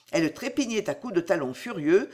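ordinary delivery

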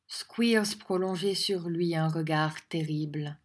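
usual speaking voice

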